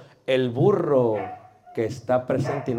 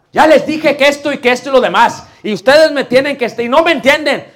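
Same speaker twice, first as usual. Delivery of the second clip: much higher and louder mock yelling; shouting preacher; 10 semitones higher, 11 dB louder than usual. Imitating a brother who yells the whole sermon, mock shouting. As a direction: rant at the congregation like a shouting preacher. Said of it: "Grita la mano".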